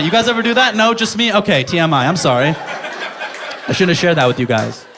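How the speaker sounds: usual speaking voice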